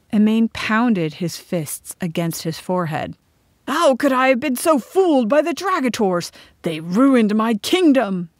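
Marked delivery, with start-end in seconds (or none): 3.7-8.3 loud, raised wail of self-reproach; anguished outburst. A narrator's reading. Emain pounds his forehead over his lost kingdom, wailing. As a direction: lament it loudly, dragging out the last words.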